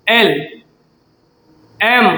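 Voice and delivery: एल and एम each said slowly and on its own; slow correct letters. Shown as a demonstration. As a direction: spell out एल, then एम, with pauses. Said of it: "ये ऐसे पढ़ा जाता है".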